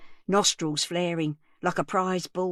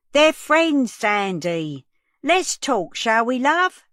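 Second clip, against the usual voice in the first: loud, high coaxing call; old woman calling to calm a screaming girl outside; 5 semitones higher, 7 dB louder, slower than usual. Acted. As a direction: call through the glass, soothing but loud. Calling through glass door.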